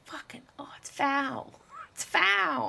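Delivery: high, drawn-out "foul" with falling tune; disgusted. Real, not acted. Disgusted verdict.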